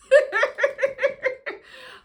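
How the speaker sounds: a high, loud burst of laughter; helpless high laughter. Real, not acted. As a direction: crack up at the memory. Laughing at her own story.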